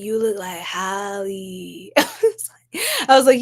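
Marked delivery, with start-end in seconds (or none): 0.0-1.9 dudes' comment. Retold or imitated. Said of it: "they were like".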